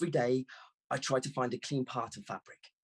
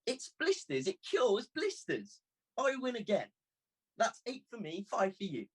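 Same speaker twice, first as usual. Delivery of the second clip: put-on high, triumphant little-girl voice; clapping girl counting her wins; gleeful girl; 9 semitones higher than usual. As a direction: gloat happily over the score. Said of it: "She clapped her hands together".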